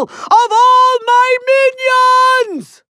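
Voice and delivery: high, drawn-out theatrical cry; grand villain shout. Acted. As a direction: build it into a long, high boast. Villain announces his monster.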